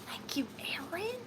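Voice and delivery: breathy unvoiced thank you to a chat friend; whispered thanks. Real, not acted. Host whispering thanks to a viewer in chat, hushed. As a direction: whisper it close to the mic.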